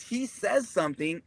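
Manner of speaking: ordinary talk, nothing marked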